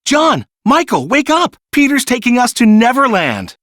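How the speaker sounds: high, loud urgent calling; excited wake-up call. A narrator's reading. Wendy waking her brothers, high excited calling. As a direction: rouse your brothers with thrilled urgency. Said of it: "Wendy said".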